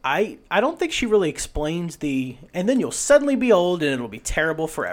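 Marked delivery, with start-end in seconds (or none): 2.5-4.9 louder, higher hammed-up warning; mock explanation. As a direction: act out a mock warning, raised and loud. Acts out the vampire's unspoken explanation.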